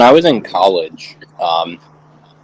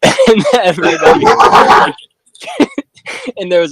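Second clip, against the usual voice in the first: laughing through the words; high-pitched laugh mid-sentence; 5 dB louder than usual. Real, not acted. Laughing while telling funny story.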